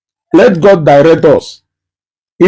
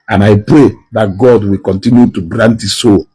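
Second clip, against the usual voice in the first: solemn prayer; 9 semitones lower than usual. Acted. Speaker praying for the late senator, low solemn prayer.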